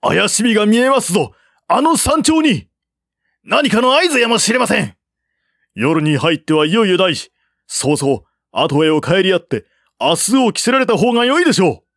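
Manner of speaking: high, fast alarmed shouting; urgent warning. A narrator's reading. Officers pointing at the mountain, urgent shouted warning. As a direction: warn the general urgently. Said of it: "一斉に山を指さして叫んだ".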